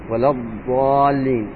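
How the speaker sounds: ولا الضالين drawn out, dad made at the tongue's edge; slow ولا الضالين with the dad from the side of the tongue. Shown as a demonstration. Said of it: "حرف الضاد". (ولا الضالين ببطء)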